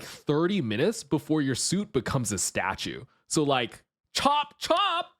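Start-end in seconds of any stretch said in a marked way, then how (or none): 4.1-5.1 cute anime streamer. Acted. Cute high-pitched urging.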